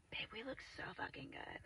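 very soft unvoiced whisper. Real, not acted. Vlogger to babe about looking good, whispered. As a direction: whisper it as a private aside.

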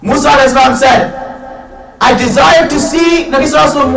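high, loud, fervent sermon climax; full-force preaching. Real, not acted. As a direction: preach with soaring intensity.

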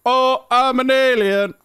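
high, loud silly alien voice; playful put-on alien cry; mock alien. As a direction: announce yourself as a goofy alien. Jokey alien announcement.

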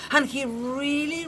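ordinary delivery

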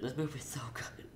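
breathy hushed whisper of awe; awed whisper. Real, not acted. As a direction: whisper it like a secret. Gushing about the movie.

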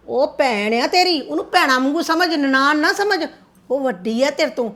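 ordinary talk

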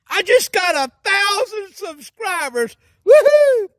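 overjoyed. Acted. Overjoyed announcement.

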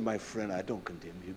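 very low, soft voice; hushed, gentle retold absolution; tender reassurance. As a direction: speak softly to comfort a guilty friend. Retells forgiving his crying friend.

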